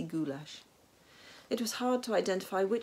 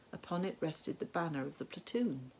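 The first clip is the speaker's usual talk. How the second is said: plain reading voice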